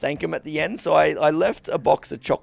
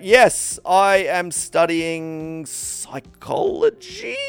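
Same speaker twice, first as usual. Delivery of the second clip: slow fake answer; much slower than usual. Faking being busy.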